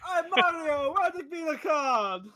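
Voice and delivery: loud, high, hammed-up Italian plumber; Mario. Plumber yelling at his brother, hammed-up Italian voice. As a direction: squeal it like a cartoon Italian brother. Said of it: "Mario Brothers".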